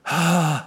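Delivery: heavy exasperated sigh; deep sigh. Acted. Preacher imitating Jesus worn down by doubters, deep sigh. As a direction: let out a deep, pained breath. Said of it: "Jesus sighed deeply".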